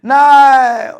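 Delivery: high, loud shouted start; hammed-up cheer; mock crowd shout. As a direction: yell it like a supporter. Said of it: "dey go shout".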